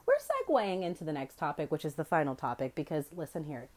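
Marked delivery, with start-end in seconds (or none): none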